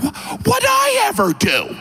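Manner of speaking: mock-offended tyrant; indignant Ivan. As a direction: protest your innocence. Ivan in denial.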